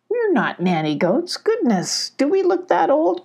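indignant goat, pitch climbing; complaining. A narrator's reading. Mellow objecting to nanny goats, indignant complaint. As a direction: protest it, ending very high. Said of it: "complained Mellow".

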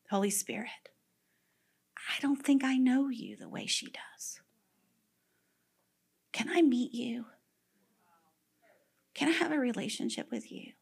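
soft, breathy whispered prayer to the Spirit; hushed prayer. Retold or imitated. Benny on his knees in his bedroom, whispering a prayer. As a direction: pray it in a small hush.

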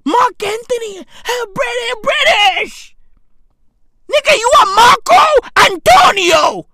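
high, loud yelling that builds; mock-outraged shouting. Comic confronting Mark Anthony over his name, mock-outraged shout. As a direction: build to an incredulous bellow.

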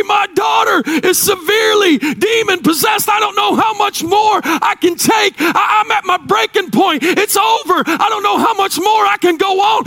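high, desperate pleading cry; a mother crying out for her daughter; crying woman's plea. Acted. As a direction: beg for help at breaking point. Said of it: "She's crying".

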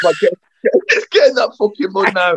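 retelling the corporal's mud order, laughing; laughing corporal imitation. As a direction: laugh while barking the order.

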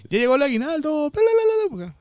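very high, loud babbled cheer; squeaky bla bla cheer. Retold or imitated. Joking with coworkers about payday with a squeaky cheer. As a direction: squeal it out to celebrate. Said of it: "Cada rato lo hacía".

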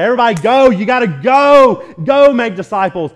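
high, loud mock rallying call, each go punched; emphatic go. Preacher imagining everybody told to leave, loudly emphatic on go. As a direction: shout go like a preacher sending everyone out. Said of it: "put all that emphasis on the word go".

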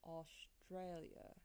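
slow, soft try at an Australian accent; attempted Australian accent. Shown as a demonstration. Host wondering how Australians say it, attempted Australian accent. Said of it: "they roll their R's a little bit".

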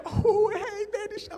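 very high, squeaky reply; flustered greeting. Retold or imitated. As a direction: play a smitten boy caught off guard. Smitten boy answers the girl.

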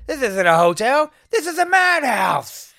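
high, loud, flustered exclamation; flustered outburst. Retold or imitated. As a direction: cry it out like a rattled hotel guest. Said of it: "all flustered from all this shit".

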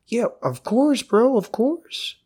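eager waiter. Retold or imitated. Narrator mocking a resort waiter, high and chummy. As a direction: play the overfriendly bro waiter. Imitating the waiter's empty promise.

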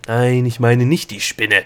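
loud, irritated retort; snapping back. A narrator's reading. Gnome correcting the girl's innocent question, barking loudly. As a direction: bark it back impatiently. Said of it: "blaffte Opal zurück".

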